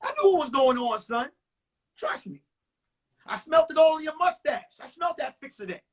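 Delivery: lightly hammed-up knowing father; father's voice. Dad telling son he smelled the girl on him, mock knowing. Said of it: "He said".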